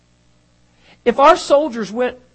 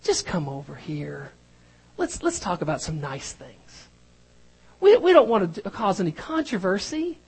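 coaxing phony preacher; 5 dB quieter than usual. Retold or imitated. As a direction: gently pull the prophet aside, pretending. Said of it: "projecting that they would say".

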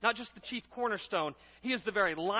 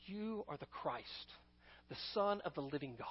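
hushed confession; 6 semitones lower, 11 dB quieter than usual. Acted. Peter confessing the truth to Jesus, hushed and solemn. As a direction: say it quietly and deliberately, weighing each phrase.

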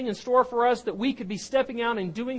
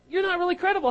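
scoffing crowd; 8 semitones higher, 4 dB louder, much faster than usual. Acted. Mocking crowd doubting Moses.

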